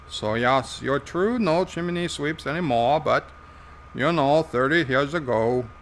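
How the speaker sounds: put-on lively Irish old-timer voice; Joe, Irish character. Joe setting the host straight about chimney sweeps, Irish character voice. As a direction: reminisce in a put-on Irish accent.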